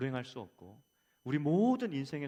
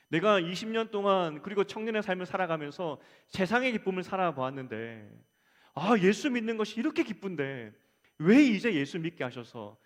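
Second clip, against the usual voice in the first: retold impassioned complaint, loud and raised in pitch; reproachful prayer; 5 semitones higher, 7 dB louder than usual. Preacher retelling a young convert's prayer to God, impassioned reproach. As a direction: plead loudly, as if taking God to task. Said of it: "이렇게 원망하며 기도했다고".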